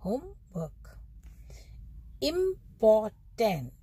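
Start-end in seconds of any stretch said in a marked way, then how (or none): 2.2-3.7 important slowly in three beats, each syllable stressed; teacher's slow model of important with three stresses. Shown as a demonstration. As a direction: break important into three drawn-out stressed parts. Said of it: "तीन stress आ रहे हैं इसमें".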